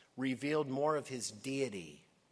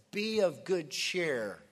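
loud, high, slow declaration; bold proclamation; 4 semitones higher, 7 dB louder than usual. Acted. Preacher quoting Jesus on the dark night, bold proclamation. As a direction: proclaim each phrase with weight.

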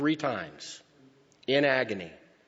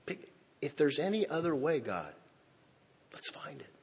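hushed prayer; 2 semitones higher, 7 dB quieter than usual. Retold or imitated. Jesus praying for another way.

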